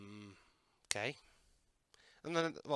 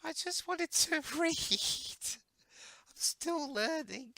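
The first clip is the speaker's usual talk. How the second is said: high character voice; slower than usual. Pretend. Voicing a cartoon character.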